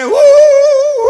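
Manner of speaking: high, hooting imitation sob; exaggerated high-pitched boo-hoo; mock wailing. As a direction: imitate crying with long, thin "oo" wails. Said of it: "બેનોને જરાક એક કલાક થાય ને".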